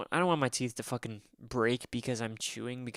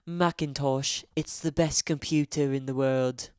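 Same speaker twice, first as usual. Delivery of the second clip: mock posh British accent, louder and slow; hammed-up British Apple snob, drawn out; 3 semitones higher, 6 dB louder, slower than usual. Mocking smug Apple users, hammed-up British accent. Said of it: "I could do a British voice".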